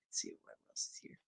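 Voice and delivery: fast whispered aside; whispered mutter. Real, not acted. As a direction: mutter it under your breath. Muttering while scrolling.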